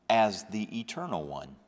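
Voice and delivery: ordinary talk, nothing marked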